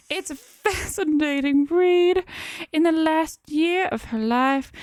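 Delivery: high, slow Southern drawl; comic Southern accent. Acted. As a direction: play a Southern gentleman, stretched out. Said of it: "You're definitely the South".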